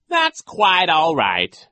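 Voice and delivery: slow, loud, high put-on voice; magnanimous villain. A narrator's reading. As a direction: forgive with theatrical show. Villain feigns forgiveness.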